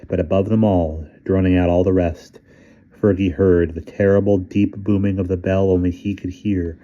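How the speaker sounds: usual speaking voice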